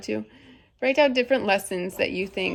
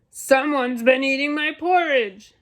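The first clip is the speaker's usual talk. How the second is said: big, raised, booming storybook bear; indignant Papa Bear, higher and forceful; great huge Papa Bear voice; 5 semitones higher, 6 dB louder than usual. A narrator's reading. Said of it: "in his great, huge voice".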